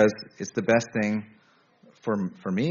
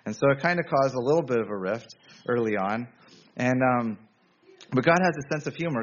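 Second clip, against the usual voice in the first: ordinary talk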